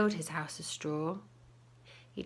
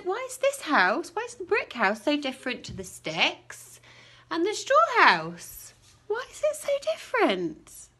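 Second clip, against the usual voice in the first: high, loud baffled wolf; wolf bewildered at the brick house; puzzled wolf; 8 semitones higher, 4 dB louder than usual. A narrator's reading. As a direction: sound amazed the house still stands. Frustrated wolf questions.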